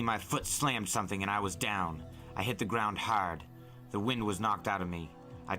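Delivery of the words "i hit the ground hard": ordinary delivery, nothing marked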